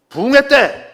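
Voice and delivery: high, loud, fervent pulpit cry; old revivalist preaching. Retold or imitated. Imitating revival preachers of old addressing their audience, fervent preaching. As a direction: exhort the congregation like a revival preacher. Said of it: "보통 이렇게 설교를 했어요".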